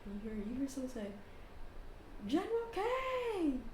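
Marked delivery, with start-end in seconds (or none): 2.3-3.6 high, drawn-out hail; calling out. Acted. Elf hailing his old general, high drawn-out call. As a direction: call out the name high and long.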